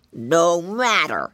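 slow, drawn-out storybook snap; snail snapping. A narrator's reading. Snail dismissing the piggy boy, slow mock snap. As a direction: cut him off with heavy, stretched words. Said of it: "snapped and snipped the snail".

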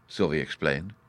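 plain reading voice

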